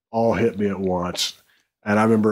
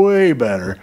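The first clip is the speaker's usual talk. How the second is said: ordinary talk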